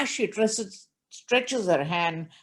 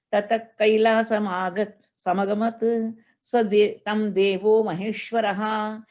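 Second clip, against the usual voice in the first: steady chanted Sanskrit recitation; scripture chant in class; verse recitation; 3 semitones higher than usual. Acted.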